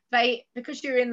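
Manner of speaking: ordinary delivery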